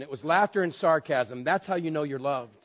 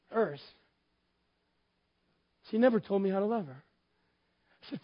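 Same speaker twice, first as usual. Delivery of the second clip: usual speaking voice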